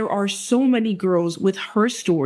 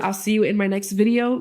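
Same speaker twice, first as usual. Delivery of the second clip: ordinary delivery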